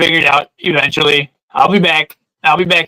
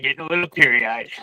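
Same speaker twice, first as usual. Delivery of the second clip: softer, voice dropping away; choked-up murmur; 3 semitones lower, 7 dB quieter than usual. Real, not acted. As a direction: let it fade as tears come.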